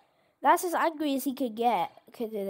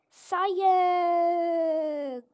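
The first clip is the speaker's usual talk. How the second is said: stretched out proclaiming cry; drawn-out exclamation; 4 semitones higher than usual. Real, not acted. Gamer in the science class, drawn-out exclamation. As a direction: announce it like a mad scientist.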